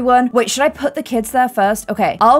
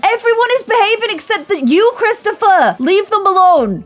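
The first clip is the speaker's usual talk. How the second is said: higher, exasperated telling-off; exasperated scolding; 9 semitones higher than usual. Real, not acted. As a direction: scold the naughty Sim, pitched up. Scolding Sim Christopher.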